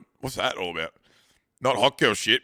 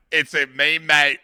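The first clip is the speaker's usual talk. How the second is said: mock Aussie protest, punched louder; louder hammed-up "mate" defence; Australian excuse-maker; 3 semitones higher, 7 dB louder than usual. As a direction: brush it off like a defensive Aussie bloke. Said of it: "this is the Australian argument".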